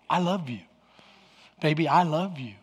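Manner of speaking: low, soft declaration of love; tender. Acted. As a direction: play a loving God, softly coaxing. God's tender appeal, acted out.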